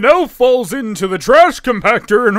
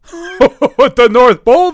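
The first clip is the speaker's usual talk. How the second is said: laughing; 6 semitones higher, 7 dB louder, slower than usual. Acted. Santa bit, laughing delivery.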